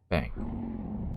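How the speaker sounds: Bang said low and soft; deep quiet voice. Shown as a demonstration. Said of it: "repeats Bang deeper".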